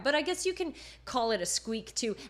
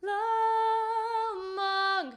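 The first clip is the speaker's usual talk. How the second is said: chest la, head mang; 11 semitones higher, 3 dB louder than usual. Shown as a demonstration. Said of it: "Hear the difference in the timbre".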